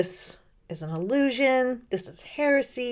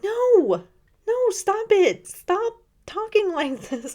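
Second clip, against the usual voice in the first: squeaky, much higher mock cry of no, no; mock protest; 12 semitones higher, 4 dB louder than usual. Host acting out her reaction to the reading, high mock protest. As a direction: play up your own horrified reaction, high and squeaky. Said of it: "and I was like".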